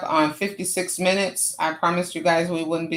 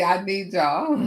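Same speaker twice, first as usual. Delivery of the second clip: ordinary talk, nothing marked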